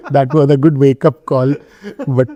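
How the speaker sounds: self-mocking laugh in the voice. Real, not acted. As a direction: chuckle through the words at your own expense. Laughs at own badminton defeat.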